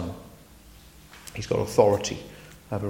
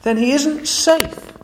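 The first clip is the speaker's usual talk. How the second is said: put-on much higher, slow, alarmed girl; Lucy alarmed; 13 semitones higher, 5 dB louder, slower than usual. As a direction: realise it with a gasp of worry. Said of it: "said Lucy".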